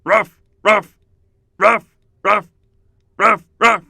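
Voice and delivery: high, loud barking woof; dog barking. Shown as a demonstration. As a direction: bark like an eager dog. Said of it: "begin barking like a dog".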